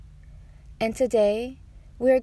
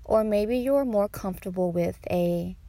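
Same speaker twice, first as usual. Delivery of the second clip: guided meditation; 4 dB quieter than usual. Acted. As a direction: guide them unhurriedly through each breath.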